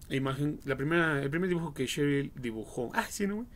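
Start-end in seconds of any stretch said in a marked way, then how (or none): none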